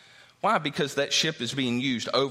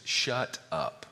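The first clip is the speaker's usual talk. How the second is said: mock-polite, low and soft "Shut up."; ironic nice way; 3 semitones lower, 3 dB quieter than usual. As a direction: say "Shut up." as if kindly. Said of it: "In a very nice way".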